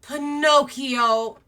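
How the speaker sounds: high, drawn-out Pinocchio; tender calling. Acted. Blue fairy greeting Pinocchio, tender and drawn out. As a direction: stretch out the name Pinocchio slowly, full of love. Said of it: "and is like".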